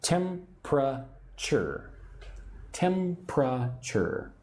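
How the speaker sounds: temperature said slowly as TEM-pruh-cher; slowly, tem-pruh-cher, three beats; three syllables. Shown as a demonstration. Teacher models temperature slowly for learners. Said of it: "I say it with three syllables".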